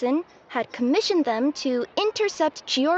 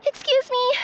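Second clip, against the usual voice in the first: frantic calling out; 10 semitones higher, much slower than usual. Acted. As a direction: shout ahead as you dash in. Courier rushing in late.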